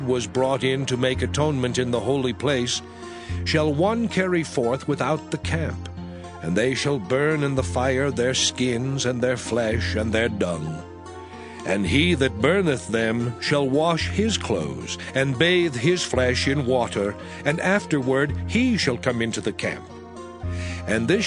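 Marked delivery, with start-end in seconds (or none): none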